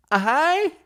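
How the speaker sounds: high, breathy little hi; mock YouTuber greeting. Podcaster mimicking a YouTuber girl's cutesy greeting. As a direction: chirp it like a cutesy YouTuber girl. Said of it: "Who's that girl who says".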